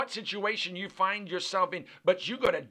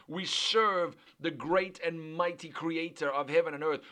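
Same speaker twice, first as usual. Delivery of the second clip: ordinary delivery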